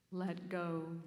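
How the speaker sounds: a hint of a calm, low divine voice; voice of God. A narrator's reading. God answers the hanging man, lightly voiced, low and calm. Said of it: "The voice said".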